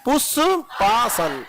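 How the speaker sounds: पुष्पासन drawn out very slowly in separate syllables. Shown as a demonstration. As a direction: break पुष्पासन into slow, distinct syllables for the class. Teacher breaks new term into syllables. (पुष्पासन को धीरे-धीरे, अक्षर तोड़कर बोलना)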